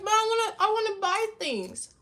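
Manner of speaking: put-on high, loud childish whine; whiny daughter. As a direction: plead like a sulky kid. Herself whining to mom.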